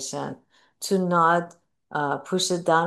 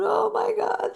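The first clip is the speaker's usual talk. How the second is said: much higher mock wail; imitated crying. Grieving mother calling somebody to cry, imitated wail. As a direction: imitate crying down the phone, pitched way up. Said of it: "And cry to them and cry".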